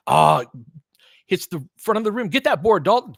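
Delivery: high, loud plea for the rebound; will the player to grab the ball; urging. Real, not acted. Fan-commentator begging Dalton for the board, urgent.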